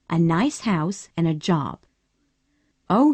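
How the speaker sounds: plain reading voice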